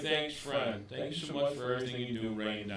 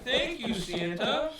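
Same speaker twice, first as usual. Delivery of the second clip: high, bright delight, laughing thanks; delighted opening a present; 4 semitones higher, slower than usual. Real, not acted. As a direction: gush with surprised joy over the gift. Opening Santa's gift on air.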